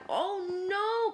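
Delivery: high, rising-pitched "oh no"; Spoon's high storybook wail; distressed cry. A narrator's reading. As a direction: cry out in dismay. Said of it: "cried Spoon".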